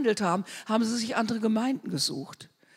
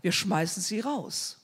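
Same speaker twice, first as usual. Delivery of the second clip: ordinary talk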